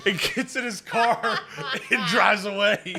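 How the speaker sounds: high, slow, drawn out through laughter; laughing. Real, not acted. Storyteller cracking up over the stranger's exit, laughing. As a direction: crack up while finishing the sentence.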